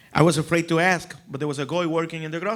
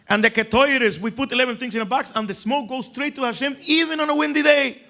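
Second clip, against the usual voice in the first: put-on excited kid; 8 semitones higher than usual. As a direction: get carried away like a boasting child. Excited kid explaining temple.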